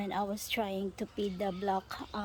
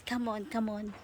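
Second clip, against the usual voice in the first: normal talking voice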